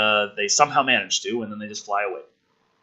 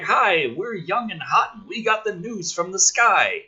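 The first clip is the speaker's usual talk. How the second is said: mock news babes; 6 semitones higher than usual.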